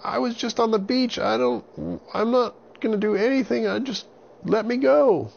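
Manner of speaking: put-on very high, loud frightened boy; scared boy voice. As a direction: plead like a panicked little boy. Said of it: "Avon said".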